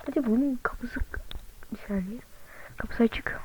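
hushed whisper. Real, not acted. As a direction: whisper it, sneaking in. Sneaking into a house.

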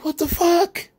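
high, slow, incredulous outburst; mock disbelief. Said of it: "And I just was like".

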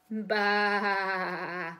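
put-on a bleating "baa" for the children; sheep bleat. Shown as a demonstration. Teacher shows little ones the sheep sound, bleating. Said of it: "It's a sheep".

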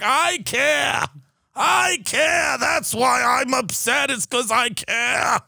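high, shouted mock sobbing plea; mock tearful Leon Cooperman. Fund manager on TV in tears over his money, high hammed-up wail. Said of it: "in tears on C N B C".

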